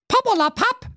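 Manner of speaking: very high, brushing-off princess. Acted. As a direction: wave him away. (sehr hoch und abwinkend)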